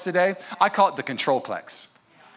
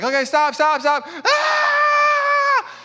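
higher, louder panicked shouts; frantic dad; 4 dB louder, much slower than usual. Retold or imitated. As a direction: yell stop at a screaming child, then scream back. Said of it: "he's like".

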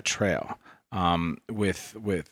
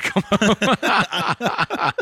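high, slow, sugary mom voice; sweet mother; 7 dB louder than usual. Retold or imitated. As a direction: coo it like an overjoyed mother welcoming her kid home. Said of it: "she said".

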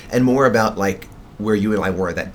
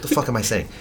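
ordinary talk

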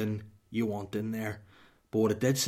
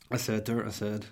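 normal talking voice

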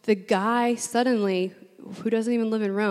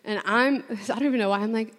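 normal talking voice

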